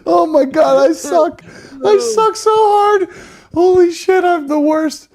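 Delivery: high, loud and half laughing; cringing at yourself, loudly; loud laughing self-mockery. Real, not acted. Embarrassed after blowing the prank.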